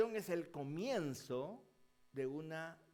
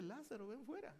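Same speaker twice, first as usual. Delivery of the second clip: softer, gentler "Lázaro, ven fuera"; soft repeat; 6 semitones higher, 6 dB quieter than usual. Retold or imitated. Jesus calling Lazarus again, softly and calm. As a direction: say it again quietly, almost to himself.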